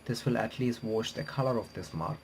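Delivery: ordinary delivery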